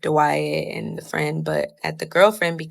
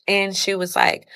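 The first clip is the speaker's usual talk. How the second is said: ordinary delivery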